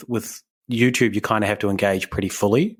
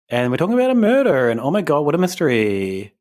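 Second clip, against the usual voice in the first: put-on high, fast, giggly Valley Girl gush; 5 semitones higher, 3 dB louder than usual. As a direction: gush about a murder like a bubbly Valley Girl. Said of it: "I did the Valley Girl voice".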